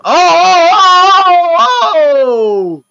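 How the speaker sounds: loud, rising oh oh as the car wrecks; alarmed shouting. Real, not acted. Viewer watching a rally car crash, shouting in alarm.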